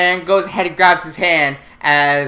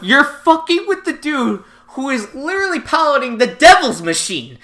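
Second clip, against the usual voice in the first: much higher, louder indignant rant, laughing at the end; mock outrage; 10 semitones higher, 5 dB louder than usual. Co-host topping the joke, high indignant mock rant. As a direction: build it into a squeaky outraged rant.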